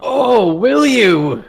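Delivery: loud, high, drawn-out taunt; mock-challenged magician. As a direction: rise to the challenge with a high, booming jeer. Magician answers player's challenge.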